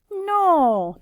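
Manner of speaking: a loud, high, firm no; emphatic no. Shown as a demonstration. Teacher answering the question for the class, loud firm no. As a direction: call out the no sharply.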